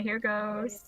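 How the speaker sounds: usual speaking voice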